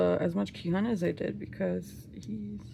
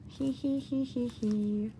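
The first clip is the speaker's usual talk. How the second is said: giddy giggle; 2 semitones higher than usual. Real, not acted. Giggling over the albums.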